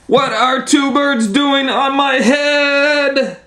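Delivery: high, slow, drawn-out outcry; dismayed wail. Acted. Elephant upset by two birds on his head, wailing high. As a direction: wail the question in alarm.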